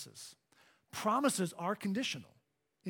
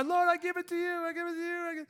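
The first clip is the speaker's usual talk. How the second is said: high, insistent mock pleading; pleading altar prayer; 9 semitones higher, much faster than usual. Preacher re-enacting his own altar prayer, high pleading. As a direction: repeat it high, like begging.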